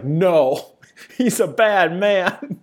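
loud, high imitation of an outraged refusal; hammed-up disapproval, drawn out slowly at the end; mock condemnation. Acted blunt refusal in retold exchange.

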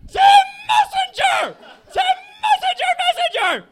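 very high, very loud creature scream of the name; Pokemon shouting match. Acted. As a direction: yell it like a furious cartoon creature. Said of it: "arguing with Pikachu".

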